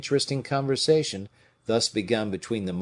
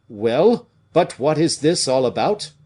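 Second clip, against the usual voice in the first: louder, commanding demand; with authority; 4 semitones higher, 6 dB louder than usual. A narrator's reading. King commands an answer, with authority. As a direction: cut in and take command. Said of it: "said the king with authority".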